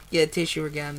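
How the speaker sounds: ordinary delivery, nothing marked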